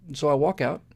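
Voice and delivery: normal talking voice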